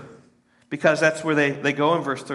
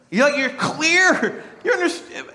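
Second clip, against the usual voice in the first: high laughing aside; laughing after the wah joke; 12 semitones higher than usual. Real, not acted. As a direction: chuckle through the words.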